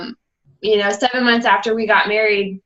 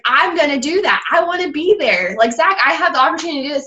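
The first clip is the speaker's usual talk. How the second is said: higher-pitched excited retelling; eager determination; 5 semitones higher, faster than usual. Retold or imitated. Wife pitches Summit opportunity to husband, eager. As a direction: rush into it eagerly, voice lifted.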